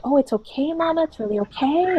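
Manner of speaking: put-on childlike client. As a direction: reassure mama like a small boy. Client voiced as child to mama.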